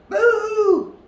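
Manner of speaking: loud, high scare; shouted boo. Acted. The animals ambush the cub with a loud boo. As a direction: jump out with a big boo.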